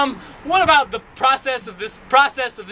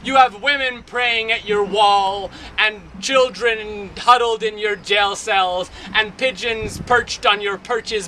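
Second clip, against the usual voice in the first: normal talking voice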